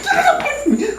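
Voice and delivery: very high panicked squeal; freaking out. Retold or imitated. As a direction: act out shrieking to be saved. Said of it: "I start freaking out".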